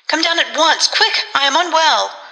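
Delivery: high, anxious plea; urgent cry for help. A narrator's reading. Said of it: "Her voice rose suddenly to a scream".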